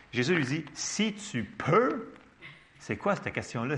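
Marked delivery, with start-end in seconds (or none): 0.7-2.1 high, very drawn-out questioning rise; incredulous echo. Acted. Jesus questioning the father's doubt, incredulous. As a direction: throw it back as a stretched question.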